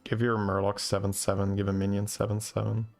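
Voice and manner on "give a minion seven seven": normal talking voice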